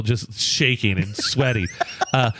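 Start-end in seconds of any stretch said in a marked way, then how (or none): none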